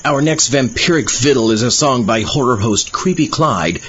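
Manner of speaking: ordinary delivery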